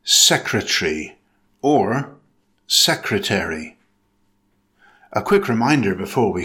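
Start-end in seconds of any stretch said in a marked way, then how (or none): 0.0-1.2 secretary in three syllables, SEK-ruh-tree; British "secretary", first syllable stressed, middle vowel dropped; British pronunciation. Shown as a demonstration. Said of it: "In British English, it's pronounced with three syllables". 2.7-3.8 secretary in four syllables, SEK-ruh-tair-ee; American "secretary", first syllable stressed, -tary fully voiced; American pronunciation. Shown as a demonstration. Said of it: "In American English, it's pronounced with four syllables".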